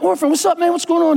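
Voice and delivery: high-pitched retold hello; animated greeting. Preacher greeting Orphan at the pizza shop, animated greeting. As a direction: call out to a friend, lively.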